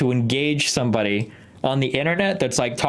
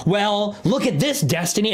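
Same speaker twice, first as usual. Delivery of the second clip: mock gloating troll; 8 semitones higher than usual. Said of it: "people bring up".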